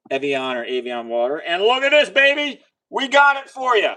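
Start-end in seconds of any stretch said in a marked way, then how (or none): 1.4-3.9 loud, high showman's flourish; excited showing off. Real, not acted. Host showing viewers the water he has, excited showing off. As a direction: present it with a big high-pitched flourish.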